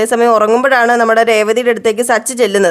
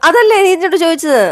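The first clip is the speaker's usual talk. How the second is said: pitched much higher, mock-innocent; teasing question; 8 semitones higher than usual. [name] teasing Sachi about his question, high mock-innocent tone. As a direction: tease him with a high sing-song.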